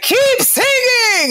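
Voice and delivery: high, loud, slow drawn-out shout; cheering shout. Retold or imitated. Friend at the top of the stairs cheering her on, loud. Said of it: "She's like".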